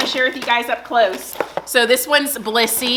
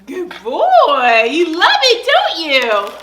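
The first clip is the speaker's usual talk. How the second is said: pet talk; 6 semitones higher, 6 dB louder, slower than usual. Real, not acted. Praising the dog.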